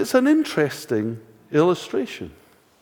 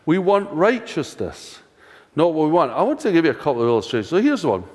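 usual speaking voice

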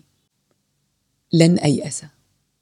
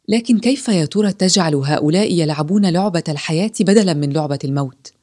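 plain reading voice

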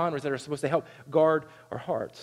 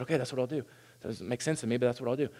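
lower, softer grudging agreement; reluctant Moses; 5 semitones lower, 6 dB quieter, faster than usual. Retold or imitated. Moses answering God, lower and softer, grudging. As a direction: mutter a doubtful okay to God.

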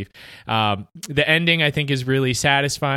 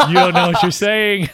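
laughing through a retold reply, higher and louder; laughing retort; 7 semitones higher, 6 dB louder than usual. Podcaster retelling his reply to his unknowing fiance, laughing. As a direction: burst out over the words, amused.

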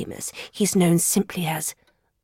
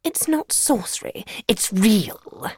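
smug cat; 13 semitones higher than usual. A narrator's reading. Cat answering the appalled dog, smug. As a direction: let it land with self-satisfaction. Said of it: "replied the smug-looking cat".

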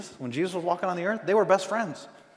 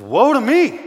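loud, high cry of woe; anguished cry; 9 semitones higher, 11 dB louder, slower than usual. Retold or imitated. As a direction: cry out as a man undone before God. Isaiah cries out before God.

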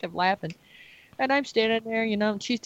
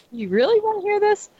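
playful disbelief; 10 semitones higher, 5 dB louder than usual. Real, not acted. Teasing the listeners.

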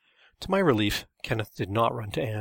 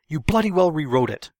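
loud, high angry outburst; fuming yell; 5 semitones higher, 8 dB louder than usual. A narrator's reading. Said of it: "Todd was fuming and yelled".

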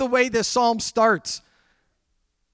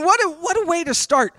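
normal talking voice

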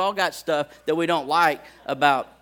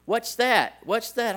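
put-on high insistent toddler voice; repeating child; 7 semitones higher, 4 dB louder, slower than usual. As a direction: nag like a little kid.

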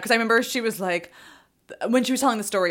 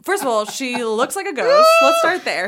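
laughing rant; 5 semitones higher, 7 dB louder than usual. Real, not acted. Excited rant about the ghost nun.

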